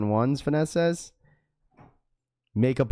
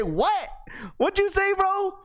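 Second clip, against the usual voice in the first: very high, baffled mock voice; slower than usual.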